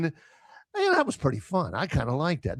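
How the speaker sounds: hushed, sheepish fan talking to a loved one; private admission. Retold or imitated. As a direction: confess it quietly like a secret. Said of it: "In private ... you're saying".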